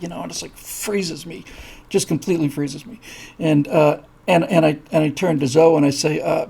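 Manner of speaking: ordinary delivery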